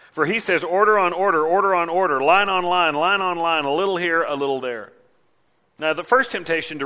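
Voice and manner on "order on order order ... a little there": singsong recital, starting loud and trailing off; mimicking a sermon. Leaders parroting the prophet's sermon, mocking singsong. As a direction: chant it in even, repetitive beats. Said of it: "they mimic his sermon".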